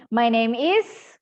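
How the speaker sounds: "is" rising like a question; rising intonation. Shown as a demonstration. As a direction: slowly swing "is" upward. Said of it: "intonasinya jangan naik di situ".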